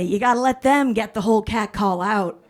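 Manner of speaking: normal talking voice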